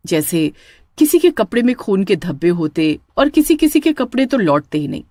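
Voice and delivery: ordinary delivery, nothing marked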